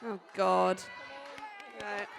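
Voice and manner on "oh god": ordinary delivery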